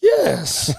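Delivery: very high, put-on little yes; mother-in-law's high yes; squeaky yes. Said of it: "heavy Croatian accent".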